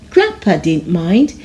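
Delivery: ordinary delivery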